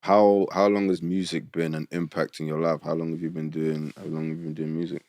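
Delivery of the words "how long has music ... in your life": ordinary talk, nothing marked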